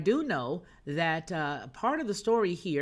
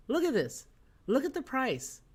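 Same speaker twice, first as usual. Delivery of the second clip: astonished; 7 semitones higher than usual. Real, not acted. Shocked at house prices.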